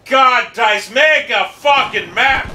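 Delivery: exasperated rage. Real, not acted. Gamer berating the developers over the map, exasperated rage. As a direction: stretch the words in real frustration.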